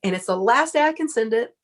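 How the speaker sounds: rally the whole line behind you; announcing to a crowd. Retold or imitated. Announcing her Oprah tape to the post office crowd, excited and high. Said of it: "And I said".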